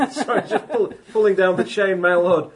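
high, loud, through laughter; real laughter breaking into the words; laughing. Real, not acted. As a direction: apologise while cracking up. Cracks up at the table.

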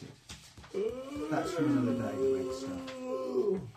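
slow, drawn-out zombie groan. Acted. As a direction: drag the words like a zombie. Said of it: "That's an excellent zombie impression".

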